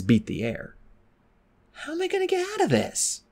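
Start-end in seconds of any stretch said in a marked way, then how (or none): 1.7-3.2 high, softer worried thought; anxious inner thought. A narrator's reading. Clay thinking during the fight, worried. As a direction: wonder it under your breath, high. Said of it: "Clay thought".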